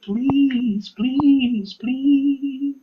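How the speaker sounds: high, drawn-out pleading please; begging. Retold or imitated. Author begging his editor to read the new book, high whiny pleading. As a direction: beg like your life depends on it. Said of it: "I begged my editor".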